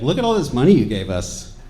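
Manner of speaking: loud, mildly gloating taunt; mock bragging. Mascot taunts customers about all their money, mock gloating. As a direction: play a company rubbing it in.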